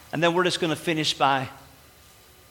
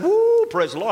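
high excited whoop, praise the Lord; joyful woo; 12 semitones higher, 5 dB louder than usual. Pretend. Preacher acting out a listener feeling God, excited whoop. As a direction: burst out in sudden joy.

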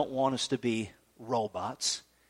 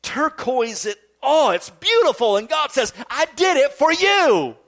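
high, forceful sermon delivery; impassioned preaching; 7 semitones higher, 5 dB louder than usual. Acted. Preacher voicing God's love to the congregation, impassioned. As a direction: preach it with swelling fervor.

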